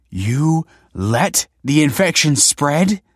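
high, slow, shocked; wife aghast at him; horrified disbelief. A narrator's reading.

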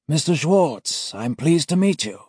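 put-on high slow polite old gent; senior auditor greeting. A narrator's reading. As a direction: greet him with chilly courtesy. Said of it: "Alex jerks".

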